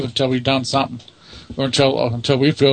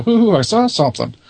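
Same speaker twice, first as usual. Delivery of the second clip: higher-pitched mock ghost-spotting hoot; mock excitement; 7 semitones higher than usual. Investigator parodying thrill-seekers to the host, mock excitement.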